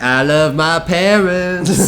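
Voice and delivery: hammed-up earnest statement; mock declaration. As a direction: announce it like a grand proclamation. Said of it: "Say anything right now".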